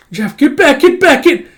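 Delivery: very high, loud panicked shout. Acted. A character warns others back, shouting. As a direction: shout frantic warnings. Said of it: "throat's getting dry from all the shouting".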